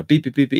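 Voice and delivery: quick mimicked computer bleeps; short string of machine beeps; imitated error beeps. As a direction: chirp out a rapid error alert. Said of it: "Windows error".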